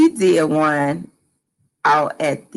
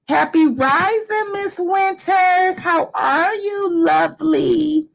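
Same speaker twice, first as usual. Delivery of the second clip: sweet greeting; 10 semitones higher, slower than usual. Real, not acted.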